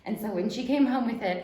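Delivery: usual speaking voice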